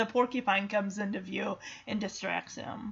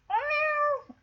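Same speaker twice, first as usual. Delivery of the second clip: very high imitated cat meow; Sassy. Cat answering the dog who called her, high mock meow. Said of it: "And she's like".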